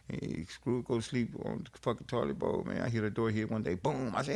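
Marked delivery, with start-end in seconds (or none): none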